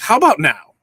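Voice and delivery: loud quick mic check. Real, not acted. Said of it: "mic check".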